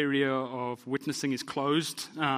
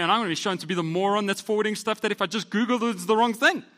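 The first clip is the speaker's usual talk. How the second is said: rising, fast, ever louder panicky worrying; anxious spiral; 4 semitones higher, 6 dB louder than usual. Retold or imitated. Pastor replays his online worry, fast rising panic. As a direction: spiral into a panic about a social media comment. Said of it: "sit and go like".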